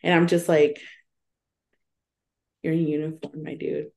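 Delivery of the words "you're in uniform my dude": ordinary delivery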